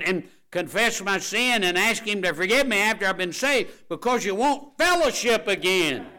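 high, loud, driving preacher voice; full-force revival preaching. Acted. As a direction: build and drive it home.

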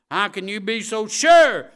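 high, very loud mock objection; loud imitated challenge from a skeptic; doubter's loud challenge. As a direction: throw out the question like an indignant doubter.

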